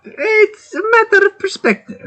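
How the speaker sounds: very high-pitched, bad German accent; squeaky botched attempt at a German accent. Shown as a demonstration. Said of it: "That's a bad German accent".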